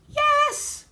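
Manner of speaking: very high loud squeak; squeaky little yes; tiny mouse voice. Acted. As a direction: answer from hiding like a tiny mouse. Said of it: "came a muffled voice".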